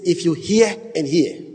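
"hear and hear" drawn out for weight; slow, weighty "hear and hear"; emphatic repetition. Shown as a demonstration. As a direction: stretch both words in "hear and hear". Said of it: "the most emphatic form of the language".

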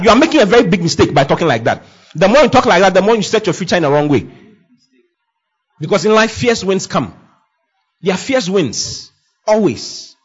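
ordinary talk, nothing marked

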